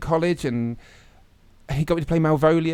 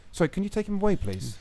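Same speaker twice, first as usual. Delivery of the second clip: ordinary talk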